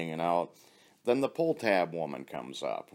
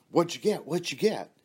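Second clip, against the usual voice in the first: eager asking; 10 semitones higher than usual. Retold or imitated.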